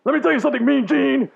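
high, loud wrestling promo voice; pro wrestler. Acted. Host mock-ranting to Mean Gene as a jacked-up wrestler. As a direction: hype it up like a ringside interview.

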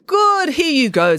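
high, loud, drawn-out handover; showy cheer. A narrator's reading. Scheherazade hands her sister cake, showily cheerful. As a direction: stretch it out brightly, way up high.

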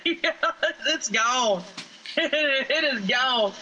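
normal talking voice

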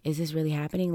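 quiet, stunned disbelief; hushed retelling of dazed shock. Retold or imitated. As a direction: say it softly, as if in shock. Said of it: "that first state of shock".

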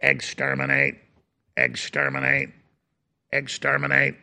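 low monotone mechanical chant; Dalek. Acted. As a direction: repeat it evenly and softly like a killer robot. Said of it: "it's the Daleks from Doctor Who".

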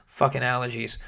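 usual speaking voice

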